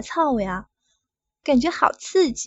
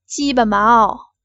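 slow, louder, teasing drawn-out call; flirty; 7 dB louder, slower than usual. Acted. Woman performing for the manager, slow flirty call. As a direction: lure him, making it sultry. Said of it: "叫的浪点".

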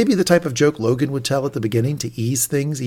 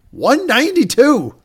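much higher, louder, slow announcement; triumphant reveal; 13 semitones higher, 9 dB louder, slower than usual. Retold or imitated. Announcing the huge revised offer to the plane, triumphant. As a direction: drop the big number like a win.

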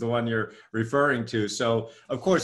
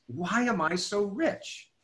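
slow, musing question; slow wondering; 7 semitones higher, much slower than usual. Shown as a demonstration. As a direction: ask yourself slowly, wondering. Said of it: "my next thought was".

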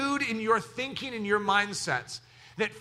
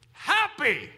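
happy interjection; 5 semitones higher than usual. Shown as a demonstration. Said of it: "here's how it works".

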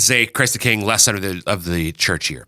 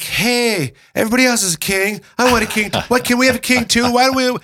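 much higher, fast, pleading crowd voice; whiny Israelites; 13 semitones higher, faster than usual. Acted. Host voicing the Israelites begging for a king, high and fast. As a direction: beg for a king like a jealous kid.

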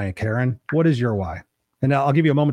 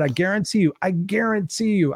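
higher, slowing insistence; raised, deliberate guarantee; emphatic insistence; 7 semitones higher than usual. Retold or imitated. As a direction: lean hard on guarantee. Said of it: "And I said".

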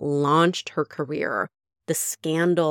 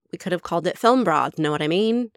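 put-on Mae West accent; 2 semitones higher, 3 dB louder, faster than usual. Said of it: "you can't not do the accent".

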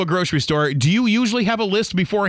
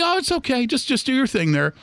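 meek accommodating; 7 semitones higher than usual. Retold or imitated. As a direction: back down politely, pitched up. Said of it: "I'm just like".

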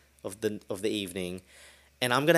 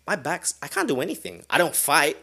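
ordinary talk

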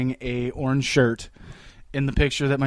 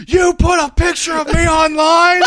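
imitating dad, shouting high and loud with a laugh; mock angry dad shout; 12 semitones higher, 8 dB louder than usual. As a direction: play the furious dad yelling, laughing through it. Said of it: "He was pissed".

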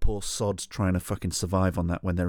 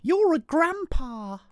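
put-on very high, slow little-kid voice; small kid's voice; much slower than usual. A small boy telling a stranger he is old, high and slow. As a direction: squeak it out slowly like a five-year-old. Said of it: "he looks up at me and he goes".

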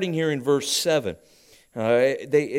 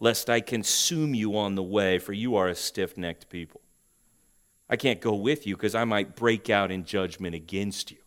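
much softer, lower, grave warning; preacher reading God's warning, hushed and heavy; quiet ominous God; 6 semitones lower, 6 dB quieter than usual. Acted. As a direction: let the threat sink in quietly. Here's God telling us how he really feels.

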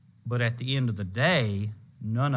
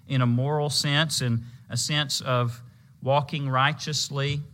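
ordinary talk, nothing marked

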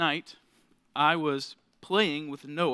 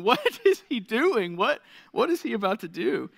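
higher, louder mock bafflement; baffled dad; 5 semitones higher, 5 dB louder than usual. Dad watching his baby act oddly, retold bafflement. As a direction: act out disbelief, stretching the first question. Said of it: "still watching him just going".

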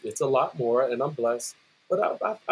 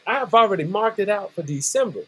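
ordinary delivery

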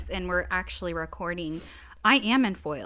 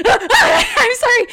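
high, loud startled yelp, then a flustered sorry; mock-startled man blurting an apology; 14 semitones higher, 14 dB louder than usual. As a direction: imitate a delivery guy jumping in fright. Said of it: "And he goes".

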